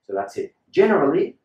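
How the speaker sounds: ordinary talk, nothing marked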